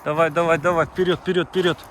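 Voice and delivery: loud, urging call to run ahead; calling out to dogs. Real, not acted. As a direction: hurry the dogs forward, loudly. (громко подгоняет собак)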